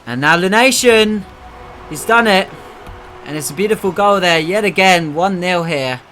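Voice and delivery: loud rising goal shout; roaring play-by-play for a goal; excited goal call. Real, not acted. Goal called in live commentary.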